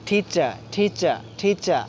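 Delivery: "টিচার" slowly, aspirated ঠ and no final R; silent R. Shown as a demonstration. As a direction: drill "টিচার" as thi-cha, slow, R left silent. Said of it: "আর উচ্চারিত হয় নাই".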